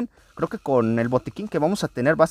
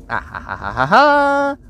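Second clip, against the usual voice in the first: mock evil laugh; 3 semitones higher, much slower than usual. Mock villain laugh while playing.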